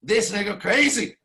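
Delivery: normal talking voice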